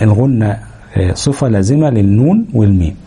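normal talking voice

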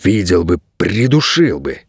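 low, slowed hissed threat; hissing. A narrator's reading. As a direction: hiss it with menace. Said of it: "прошипел Найрет".

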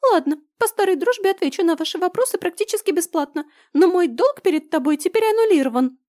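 high squeaky shouting trader; shrill little creature yelling. Acted. Blau's squeaky voice again.

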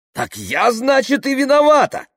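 outburst climbing to a high, loud cry; indignant. A narrator's reading. Tamara blamed by the crowd, indignant outburst. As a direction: protest hotly at being blamed. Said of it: "возмутилась Тамара".

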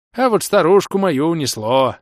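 put-on high, loud, gleeful old voice; gleeful old man. A narrator's reading. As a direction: announce it with odd delight. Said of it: "Так радостно сказал".

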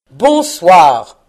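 very loud, falling goodnight; angry dismissal. A narrator's reading. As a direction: boom the word to end the talk. Scrooge congédie avec plus de force. (congédiement furieux et tonnant)

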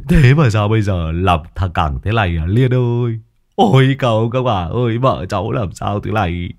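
husband wailing to the neighbors; mock sobbing plea to the old ladies; overdone grief, calling out for help; hammed-up lament. A narrator's reading. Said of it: "hắn khóc than kể nể".

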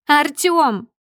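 high, loud cry of his name; angry shout. A narrator's reading. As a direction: yell at your husband to stop him. Wife yells husband's name, grabbing him. (гневный высокий окрик)

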